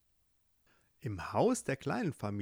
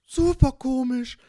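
very high, much louder critter cry; tiny firefly; 12 semitones higher, 12 dB louder than usual. Acted. Firefly outdoes the ladybug, loud high squeal.

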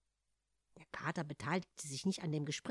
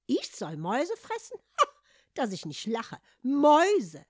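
scoffing laugh; 6 semitones higher, 8 dB louder than usual. Acted. Cat scoffs.

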